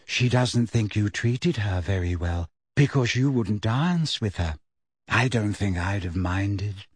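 put-on low, dreamy girl's musing; vague, thoughtful. A narrator's reading. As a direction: drift along absently. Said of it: "she added thoughtfully".

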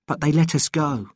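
ordinary delivery, nothing marked

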